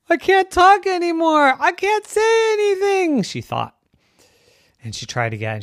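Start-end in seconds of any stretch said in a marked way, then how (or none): 0.1-3.2 gasp, then high, loud alarm; panicked girl. Acted. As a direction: squeal it in acted panic. Girl panics over lost voice.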